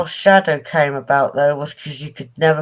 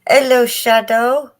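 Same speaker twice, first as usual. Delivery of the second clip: high, slow rising hello; drawn-out high-pitched pet greeting; greeting a cat; 8 semitones higher, 3 dB louder, slower than usual. Real, not acted.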